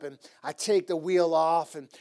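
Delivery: normal talking voice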